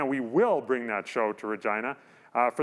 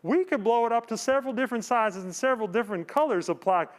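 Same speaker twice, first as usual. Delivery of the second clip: a higher, lightly voiced telemarketer pitch; mock sales caller; 7 semitones higher, faster than usual. Host retelling the caller's plaque offer, a lightly voiced sales pitch. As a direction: rattle off the sizes like a pushy saleswoman. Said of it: "So she said".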